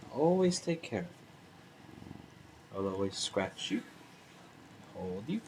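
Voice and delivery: tender cooing. Real, not acted. Owner murmuring lovingly to his cat, soft and low. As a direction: croon it softly to your cat.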